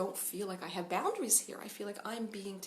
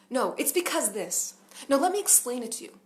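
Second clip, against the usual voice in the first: pushy explaining parent; 4 semitones higher, 8 dB louder than usual. Retold or imitated. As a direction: talk over the child with a loud explanation. Parents explaining instead of listening.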